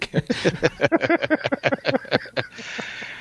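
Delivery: high laugh breaking through; high breathy laugh. Real, not acted. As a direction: laugh in spite of trying. Cohosts joking about money.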